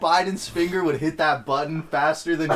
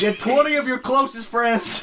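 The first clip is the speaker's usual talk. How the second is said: president voice; 9 semitones higher than usual. Pretend. Mock Biden address.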